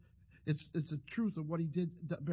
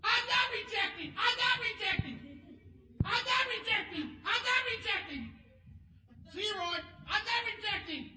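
imitated shouting; 14 semitones higher than usual. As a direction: shout it after someone walking away. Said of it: "he went".

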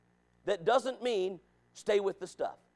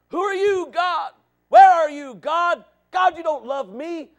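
loud, higher, bitter cries at God; complaining at God; 8 semitones higher, 10 dB louder, slower than usual. Retold or imitated. Preacher imitates people cursing God, in loud mock anguish. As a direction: rail at God like a wronged complainer. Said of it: "speaking like that".

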